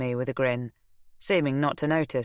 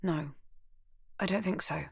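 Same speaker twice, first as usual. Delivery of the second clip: softer, unsure denial; shaken denial; 3 semitones higher, 7 dB quieter than usual. Acted. Beth hides her fear from Nell, quiet. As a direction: deny it without conviction.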